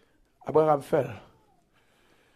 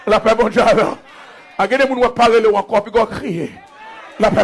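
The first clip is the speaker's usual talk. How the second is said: usual speaking voice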